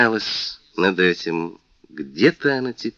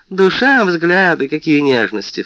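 mocking, high and louder; sneer, mock the sweetness; sneering fake sweet; 8 semitones higher, 4 dB louder than usual. A narrator's reading. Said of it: "фыркнула она".

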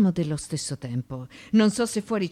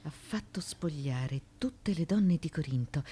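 whispering; 8 dB quieter than usual. Acted. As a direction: whisper it under your breath. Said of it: "Ma cosa bisbigli?".

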